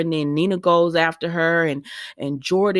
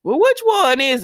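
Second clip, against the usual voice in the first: sharp, higher, louder "which one is?"; suspicious question; 9 semitones higher, 5 dB louder than usual. Retold or imitated. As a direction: call out the excuse with a raised, suspicious voice. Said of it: "And they said".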